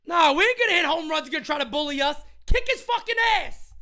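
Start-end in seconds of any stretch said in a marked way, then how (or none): 2.5-3.7 yelling for a fight. Real, not acted. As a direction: scream at him to go fight.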